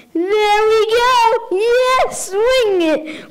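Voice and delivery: high, loud mock cheer; hammed-up kid whooping it up; gleeful cheering. Kid dancing, acted out loudly.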